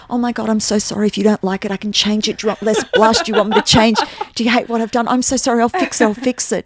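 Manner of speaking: frantically backpedal, sure she hates it; panicked apology. Retold or imitated.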